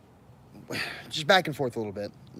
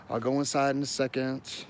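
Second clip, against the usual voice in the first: ordinary delivery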